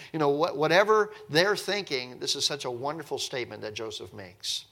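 ordinary talk

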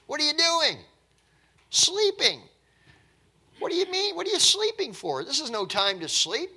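high, incredulous scolding; loud, high-pitched captain's rebuke; alarmed ship's captain. Acted. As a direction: rouse a sleeper in urgent disbelief. Captain acted out rousing Jonah.

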